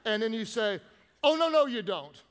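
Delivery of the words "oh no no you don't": loud, high sassy 'Oh, no, no, you don't'; sassy attitude. Shown as a demonstration. Pastor showing church how to refuse gossip with attitude. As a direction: give it full attitude. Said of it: "You gotta start it off like this".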